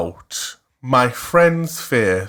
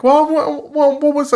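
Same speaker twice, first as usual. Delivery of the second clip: confused high question; 4 dB louder than usual. Retold or imitated. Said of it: "I was just like".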